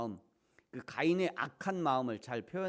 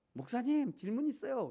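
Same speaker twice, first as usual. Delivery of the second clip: put-on young man's question; 11 semitones higher, 3 dB louder than usual. Young man asks pastor.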